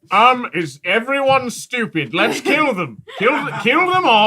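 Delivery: wolf voice. Acted. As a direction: bark it out like a hungry wolf. Wolf urges killing the party.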